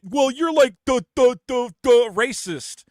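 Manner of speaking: mocking a dumb hater. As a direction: drag out each stammered the like you can't find the word. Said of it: "And they go".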